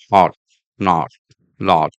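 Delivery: HOT, NOT, LOT with short rounded O; separate, rounded British O in each word. Shown as a demonstration. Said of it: "The British O sound".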